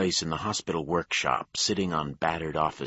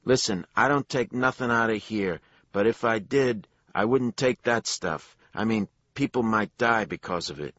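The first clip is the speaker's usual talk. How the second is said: put-on nervous Jersey accent, higher and louder; 8 semitones higher, 7 dB louder than usual. A narrator's reading. Worker denying to detectives, higher louder Jersey accent. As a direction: keep the Jersey accent, insisting he knows nothing. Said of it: "his Jersey accent as thick as mayonnaise".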